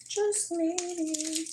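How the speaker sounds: high breathy tease; high soft voice. Shown as a demonstration. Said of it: "repeated higher softer".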